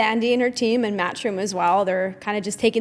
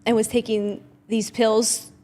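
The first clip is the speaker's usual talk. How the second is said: ordinary talk, nothing marked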